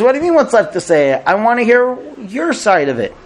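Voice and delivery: acted indignant frustration; frustrated outburst. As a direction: throw it back like a fed-up husband. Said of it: "He's frustrated".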